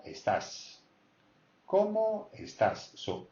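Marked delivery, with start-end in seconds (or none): 1.7-2.3 ¿Cómo? lifted well above the usual pitch; high-pitched CÓMO. Shown as a demonstration. Teacher repeats the greeting question, CÓMO high-pitched. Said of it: "high pitch because it's a question".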